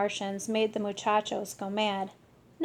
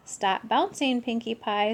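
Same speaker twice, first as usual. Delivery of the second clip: ordinary delivery, nothing marked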